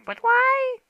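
put-on very high childish whine; squeaky voice asking why no bacon; whiny high voice. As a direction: squeak it like a spoilt kid. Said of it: "I'm like".

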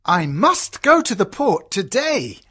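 cheerful father character; pleased fatherly voice; happy. Acted. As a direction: announce it happily as the father. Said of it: "says her father happily".

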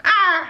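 short, high, loud "ah"; frightened yelp. Real, not acted. Scared the ring will stick, high loud yelp. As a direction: yelp in sudden panic.

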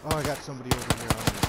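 fast, loud alarmed callout; urgent callout. Real, not acted. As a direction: rush it out in alarm. Enemy suddenly close.

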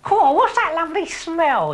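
a silly villain sniffing happily; Fred the Baddie's voice. A narrator's reading. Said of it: "he said".

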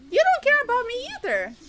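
high, loud mock-hurt cry; shouting. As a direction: wail it out, playfully wounded. Mock-hurt outburst at teammate.

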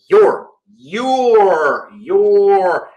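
your with the O stretched long; your dragged out, the vowel held; drawn-out your. Shown as a demonstration. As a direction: drag out the O in your. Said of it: "aquela pronúncia mais arrastada".

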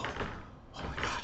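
slow, breathless whispered awe; mock awed whisper. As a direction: gasp it in a whisper like a smitten fan. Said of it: "You go".

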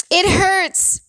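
very high hurting wail; tearful cry of pain. A narrator's reading. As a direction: wail it like a hurt child. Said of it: "pain and tears in the voice".

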